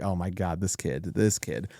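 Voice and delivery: a soft, low sigh of a worried father; hushed dismay. Retold or imitated. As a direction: murmur it, stunned by what happened to his kid. Seeing his son after the punch.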